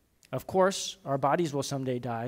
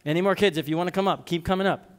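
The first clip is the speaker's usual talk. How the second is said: calling out; 4 semitones higher, 6 dB louder, faster than usual. Real, not acted. Calling kids up front.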